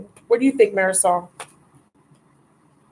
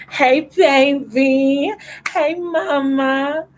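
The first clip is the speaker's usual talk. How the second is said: high, slow sing-song hello; delighted greeting; 5 semitones higher, much slower than usual. Real, not acted. Greeting her friend from Australia on the live, high and slow.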